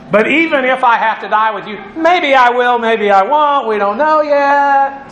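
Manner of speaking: waver playfully, stretching the last words; Peter hedging. Acted. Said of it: "Peter's hedging his bets".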